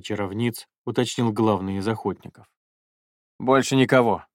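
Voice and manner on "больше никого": blurted. Acted. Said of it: "спешно выпалил Жак".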